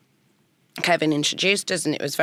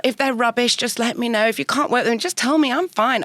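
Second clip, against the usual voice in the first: higher nervous pleading; anxious self-deprecating plea; 7 semitones higher than usual. Retold or imitated. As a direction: talk them out of liking it, voice pitched up. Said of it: "I literally wrote in that email".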